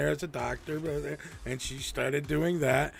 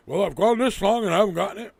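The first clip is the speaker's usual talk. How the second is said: high, loud, fast whiny mimicry; mimicking the unvaccinated, mock whiny; mocking excuse; 6 semitones higher, 5 dB louder, faster than usual. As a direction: parrot their excuse mockingly.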